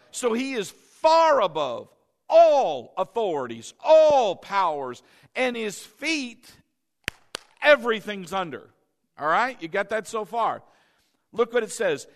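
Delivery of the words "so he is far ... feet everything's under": loud preaching build; emphatic preaching. Acted. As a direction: drive the point home to the crowd. Preaching at full force.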